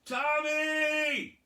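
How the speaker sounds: high loud yelled name; high shouted call to a friend; kids yelling a name. Retold or imitated. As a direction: call out from the driveway.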